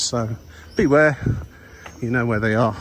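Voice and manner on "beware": beware called out, higher and louder; warning call. Real, not acted. Warning to viewers.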